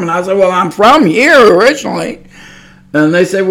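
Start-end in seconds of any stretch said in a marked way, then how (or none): 0.4-2.2 "from here" pushed high and loud; mock-indignant answer stressing "from here"; insistent retort. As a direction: insist on "from here", louder and higher. Retorting to people doubting their origin.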